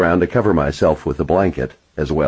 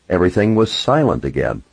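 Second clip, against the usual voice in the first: plain reading voice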